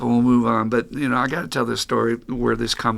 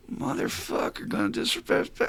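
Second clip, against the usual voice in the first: retold swearing under the breath; muttering; 2 semitones lower, 6 dB quieter than usual. Sponsor stood up by sponsee, cursing under his breath.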